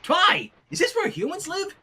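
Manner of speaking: high, eager tomboy pony voice; excited Rainbow Dash. Acted. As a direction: burst in all excited and a bit cocky.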